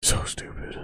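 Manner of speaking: breathy whisper of disgust; muttered whisper. Real, not acted. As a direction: whisper it to yourself.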